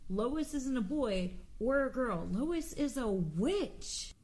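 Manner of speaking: a mild mock yell, slowing right down on Lois is a witch; mild retold yell. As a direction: playfully retell the kid yelling. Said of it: "Suddenly yells".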